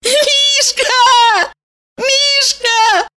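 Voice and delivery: high, bright ecstatic call; ecstatic cry. A narrator's reading. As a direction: cry out his name in delight. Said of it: "восторженно закричала Валерия".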